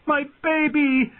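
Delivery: very high, drawn-out terrified cry; screamed. A narrator's reading. Mother with a knife at her throat, screamed. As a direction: cry out in panic, stretched and high. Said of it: "she screamed".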